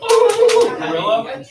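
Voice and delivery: high, laughing mock-impressed ooh; mock students. Teacher mimics students reacting to the word guerrilla, with a high laughing ooh. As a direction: tease the class by aping their giggly reaction. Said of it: "They're like".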